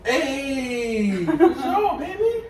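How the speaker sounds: high, drawn-out triumphant yell; celebratory cheer. Real, not acted. Cheering to partner after landing the toss on the first try, triumphant. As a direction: cheer the catch with a laugh in it.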